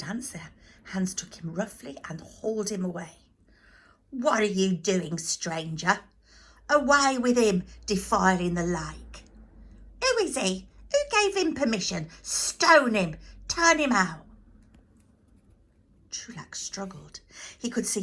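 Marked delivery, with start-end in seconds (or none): 4.1-9.2 loud, indignant shout; angry crowd. Acted. Temple crowd hauling a stranger from the lake, angry shout. 10.0-14.3 higher, loud furious yelling; outraged crowd. Acted. Crowd demanding the intruder be stoned, furious yelling.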